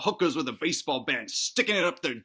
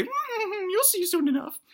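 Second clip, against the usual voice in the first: very high falsetto granny with a sly titter; teasing put-on granny voice; slower than usual. Granny hovers above the wife, tittering. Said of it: "tittering away".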